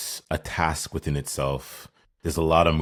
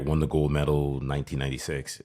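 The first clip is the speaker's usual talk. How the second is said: ordinary talk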